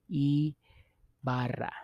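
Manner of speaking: Ibarra slowly, "eee... barra"; drawn-out pronunciation demo. Shown as a demonstration. Teacher isolating the first sound, Ibarra slow with long "ee". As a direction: split Ibarra after a long opening "ee". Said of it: "Makes that E sound".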